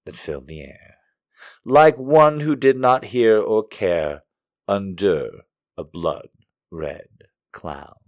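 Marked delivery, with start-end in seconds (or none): 1.6-8.0 slow, loud, solemn recitation; dramatic poem reading. Acted. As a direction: read the poem with slow grandeur.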